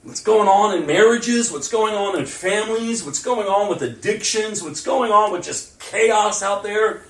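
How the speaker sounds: preaching loud. Real, not acted. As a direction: hammer each question home. Preaching at full force.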